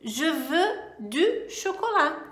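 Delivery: "Je veux du chocolat" said slowly; careful model sentence; slow clear "Je veux du chocolat"; slow French demo. Shown as a demonstration. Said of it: "Vamos lá".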